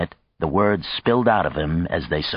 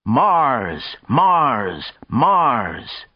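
higher-pitched chanted shout, swooping down; crowd shouting; 6 semitones higher, much slower than usual. A narrator's reading. Others in the crowd join the cheering, chanted shout. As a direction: cheer it out in repeated calls. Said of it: "And others were shouting".

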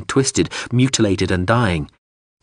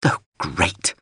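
sneering; 5 semitones lower than usual. Acted. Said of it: "Unahar Closp sneered".

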